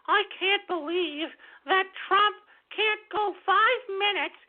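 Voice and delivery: high, slow, whiny put-on voice; mock her as shrill and prissy; mocking impersonation of a politician; mocking impression. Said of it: "did you see goofy Elizabeth Warren".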